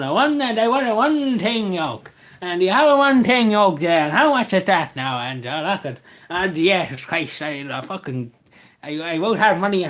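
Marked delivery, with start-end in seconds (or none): 0.0-5.2 put-on thick Cavan accent. Customer asking the price, hammed-up Cavan accent. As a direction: ham up a raised, stretched Cavan brogue. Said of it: "But a thick Cavan accent, just going".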